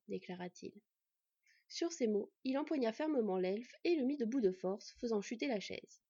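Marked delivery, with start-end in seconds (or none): none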